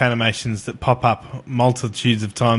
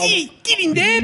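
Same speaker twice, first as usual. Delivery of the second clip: put-on child voice. Kid cheering on his dad.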